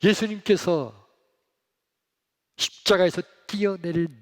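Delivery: loud, high preaching voice; fervent preaching. Acted. Pastor urging the congregation, fervent loud preaching. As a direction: preach it with full force.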